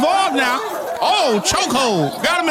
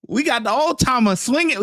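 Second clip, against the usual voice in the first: ordinary delivery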